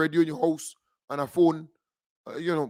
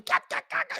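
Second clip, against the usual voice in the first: breathless whispered ka ka ka; mocking voiceless laugh; 4 dB quieter, faster than usual. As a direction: imitate a sneering cackle under your breath.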